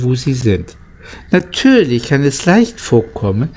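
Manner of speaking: plain reading voice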